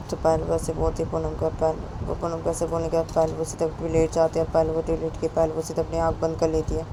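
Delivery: ordinary delivery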